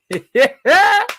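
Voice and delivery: high, loud burst of laughter; big laugh. Real, not acted. Laughing at the crying players on screen, a loud howling laugh. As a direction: howl with laughter.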